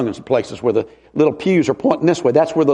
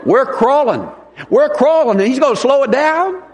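exasperated; 7 semitones higher than usual. Retold or imitated. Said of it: "exasperated about slow music".

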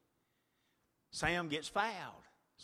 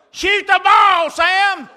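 high, full-throated shout, drawn out; yelling to son; 21 dB louder, slower than usual. Retold or imitated. Dad yelling from the stands at his son, loud shout. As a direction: scream it from the bleachers. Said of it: "I yell".

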